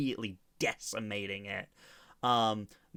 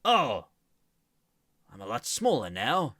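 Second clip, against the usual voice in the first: disappointed golem, a touch louder; changed golem voice; 5 semitones higher, 11 dB louder, faster than usual. Acted. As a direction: shrunken giant, a little let down. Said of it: "Why did your voice change now once you got smaller".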